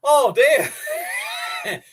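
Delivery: high shocked yelp; surprised. Real, not acted. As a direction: squeal it in disbelief. Reacting to a chat comment.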